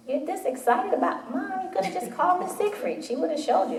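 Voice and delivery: a hint of a scoffing teen; teasing daughter. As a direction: mimic the kid rolling her eyes at mom. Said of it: "And she's like".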